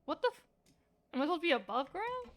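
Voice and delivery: usual speaking voice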